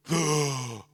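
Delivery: sharp loud intake of breath; revival gasp. Retold or imitated. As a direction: gasp back to life. Shows the man breathing again.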